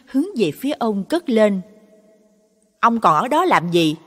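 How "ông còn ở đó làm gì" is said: high, loud, rushed scolding; sharp-tongued nagging. A narrator's reading. Wife scolds husband to go butcher the dog, loud nagging. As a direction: nag him to hurry, getting faster. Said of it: "giọng vợ ông Năm chua ngoa".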